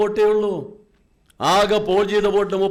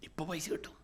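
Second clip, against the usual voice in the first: almost breathed, far softer, a mother's hush; hushed soothing; 3 semitones lower, 16 dB quieter than usual. Retold or imitated. Mother comforts her crying baby in a near hush. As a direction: murmur it to the baby, barely audible. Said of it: "ആ സ്ത്രീ കുഞ്ഞ് കരയുമ്പോൾ പറഞ്ഞു".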